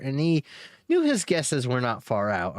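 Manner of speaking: usual speaking voice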